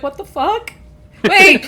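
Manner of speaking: bewildered. Acted. Dilly stares confused.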